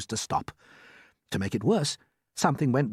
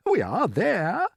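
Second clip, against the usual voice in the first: cheerful; 7 semitones higher, 3 dB louder, slower than usual. Acted. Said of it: "trying to be cheerful".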